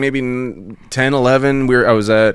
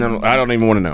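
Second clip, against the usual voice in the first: usual speaking voice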